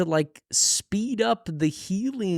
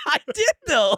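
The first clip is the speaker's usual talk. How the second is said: laughing. Real, not acted. Laughing at being praised.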